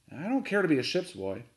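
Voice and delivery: plain reading voice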